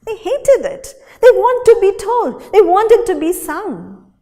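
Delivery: high, loud indignant cries; they're fed up and want out; loud, high protest. A narrator's reading. Story and song want out.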